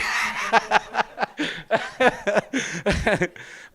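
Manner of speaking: long, high, loud laugh; real laugh. Real, not acted. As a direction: crack up at your own story. Laughs at own misunderstanding story.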